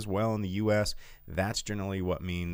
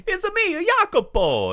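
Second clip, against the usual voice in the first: high, loud cartoon Italian accent; Mario voice; 9 dB louder, slower than usual. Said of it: "he legitimately talked like Mario".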